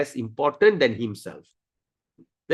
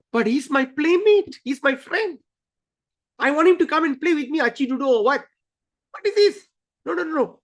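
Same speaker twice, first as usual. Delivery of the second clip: put-on high, indignant little boy; protesting child; 9 semitones higher than usual. Boy arguing with the guards, high and indignant. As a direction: insist he is your friend.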